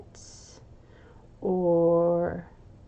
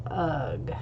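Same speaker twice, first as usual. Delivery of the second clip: breathy whispered ugh of disgust, much softer; 6 semitones lower, 7 dB quieter than usual. Acted. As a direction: let out a quiet, voiceless groan of disgust. Kid disgusted hearing about Tommy.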